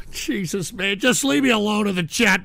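normal talking voice

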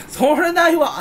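high, drawn-out cry of disbelief; imagined outburst, pitched well up; mock protest. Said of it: "ってなっちゃっただろうね". (高い声でツッコミの真似)

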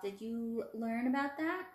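normal talking voice

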